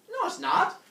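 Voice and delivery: loud, high mock contradiction; squabbling comic retort; arguing back. Acted argument over the bull.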